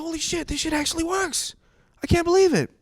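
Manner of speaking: very high-pitched astonished squeal; mock amazement. Amazed the accent trick got rid of them, high squeal. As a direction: marvel at it, voice shooting way up.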